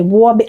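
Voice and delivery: "Robbie" opening on a rounded W sound; imitated client's accent. Vlogger trying to copy a client's pronunciation, accented. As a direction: mimic her "Robbie" as "Wawby". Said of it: "she would say".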